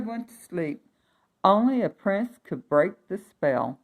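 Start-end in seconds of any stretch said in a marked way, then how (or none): none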